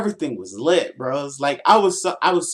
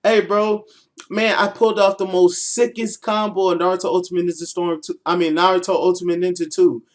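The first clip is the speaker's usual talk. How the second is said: higher, louder, eager rush; excited gamer talk; 4 semitones higher, 4 dB louder than usual. Retold or imitated. Freshman bragging about a combo to his nerdy friends, high and eager.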